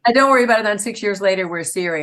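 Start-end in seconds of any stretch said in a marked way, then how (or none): 0.0-0.6 don't stressed, higher and louder in mockery; louder, higher mock reassurance; mocking repeat. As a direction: mimic the brush-off with a sneer. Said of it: "we were all told".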